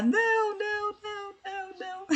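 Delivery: high, quiet wail of refusal; faraway mock shouting, high and held back; mock panicked crowd. Teacher imitates students yelling at grammar terms, high mock cry. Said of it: "todo mundo começa a gritar".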